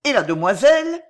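high, loud startled questions; inward roar. Acted. Carcagne recognises the two prisoners, roaring inwardly in alarm. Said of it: "rugit-il dans son esprit".

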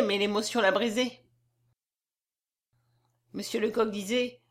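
plain reading voice

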